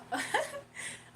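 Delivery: bright high giggle; kind gentle laugh. Real, not acted. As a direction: burst into a warm giggle. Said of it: "I'm laughing at Spirit".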